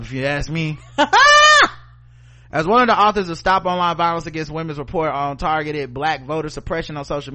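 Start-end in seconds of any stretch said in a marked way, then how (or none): 0.8-1.8 sudden loud shrieking laugh, much higher. Real, not acted. Host cracks himself up with a loud high laugh. Laughs at his own joke.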